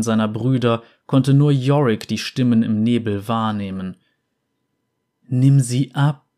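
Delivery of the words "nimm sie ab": louder, luring ghostly call; fog spirit voices. Acted.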